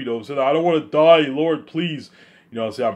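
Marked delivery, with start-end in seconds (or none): 0.4-2.1 high, loud put-on begging; mock crying plea. Begging the Lord not to die, mock baby cry. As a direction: plead like a pretend crybaby. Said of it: "gonna cry like a baby".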